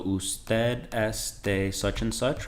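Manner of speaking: drawn out with a rising end; slow demo question. Shown as a demonstration. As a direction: ask it slowly so learners can follow. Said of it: "instant conversation".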